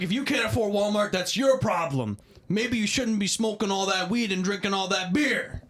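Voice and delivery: imitating lecture. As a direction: scold like a smug guard. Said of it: "he's like".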